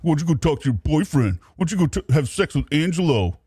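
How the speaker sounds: mocking a petulant child, lightly voiced; a hint of a sulky kid taunting; childish whining. Mocking the caller as a jealous child, mild childish whine. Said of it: "just like a child, he kept going".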